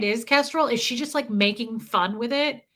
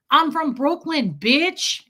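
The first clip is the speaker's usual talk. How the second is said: louder, slow mock Brooklyn swagger; aggressive New Yorker; 4 semitones higher, 4 dB louder, much slower than usual. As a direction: imitate the dude squaring up, aggressively. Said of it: "aggressive toward you".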